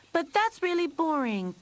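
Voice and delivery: high, loud whiny complaint; complaining. Acted. Lucy whining to her family about boredom. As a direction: whine it like a bored kid. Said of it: "Lucy complained".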